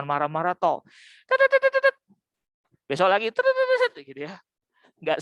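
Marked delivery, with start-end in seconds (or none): 1.3-2.0 high, loud parroted nagging noise; shrill cartoon nag imitation; mock nagging chatter. Mocking an endless nagging scold. 3.5-4.3 mock nagging chatter. Mocking an endless nagging scold.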